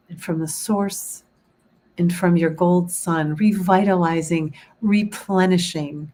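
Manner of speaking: guided meditation. Acted. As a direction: guide them gently into calm.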